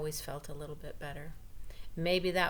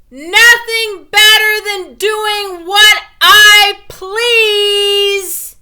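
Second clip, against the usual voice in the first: much higher and very loud, very slow; loud defiant declaration; 24 dB louder, much slower than usual. A narrator's reading. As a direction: belt it out, stretched long. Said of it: "said right out loud".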